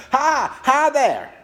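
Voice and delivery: put-on high, slow, delighted baby babble; drawn-out giggly baby hello; laughing baby reply. Baby Eric laughing back at the old man, delighted. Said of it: "Eric continued to laugh and answer".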